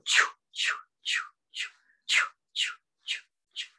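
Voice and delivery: whispery, rhythmic choo puffs; imitated engine, all breath; steam train sound. Shown as a demonstration. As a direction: chug like wheels gathering steam. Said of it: "and then it go".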